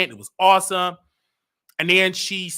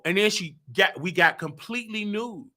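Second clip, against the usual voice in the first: usual speaking voice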